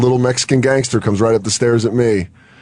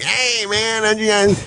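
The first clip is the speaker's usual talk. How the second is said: stoner greeting; 10 semitones higher, slower than usual. Retold or imitated.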